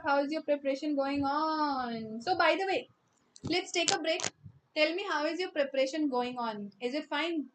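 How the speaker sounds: normal talking voice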